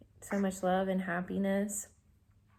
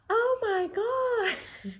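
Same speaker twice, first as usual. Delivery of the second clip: very high, slow, almost crying; amazed cry; 4 dB louder, much slower than usual. Retold or imitated. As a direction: gasp it out in wonder. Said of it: "and I'm like".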